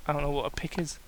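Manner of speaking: ordinary delivery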